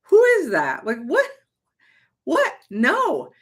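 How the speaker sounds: high, loud shocked outburst; startled at her own words; climbing to a squeaky what; alarmed. Retold or imitated. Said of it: "and I was like".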